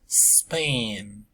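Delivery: ordinary talk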